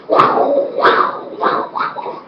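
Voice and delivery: ordinary talk, nothing marked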